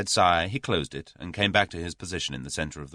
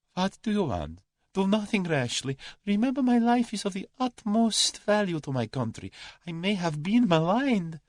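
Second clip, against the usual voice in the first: very high-pitched, pleading Russian; panicky Russian coward. A narrator's reading. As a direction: plead for your life in a high voice.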